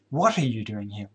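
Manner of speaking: "What" stressed, the rest falling away. Shown as a demonstration. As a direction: lean hard on the first word, "What". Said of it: "place emphasis on any one of those words".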